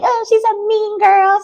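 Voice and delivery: very high, whiny mock voice; mocking complainers. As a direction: imitate critics in a squeaky high pitch. Mimics complaining people.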